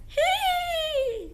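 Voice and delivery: very high squeaky hee; goblin squeal. Shown as a demonstration. Teacher shows the class a goblin's squeal. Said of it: "goblins dance".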